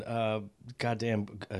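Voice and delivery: usual speaking voice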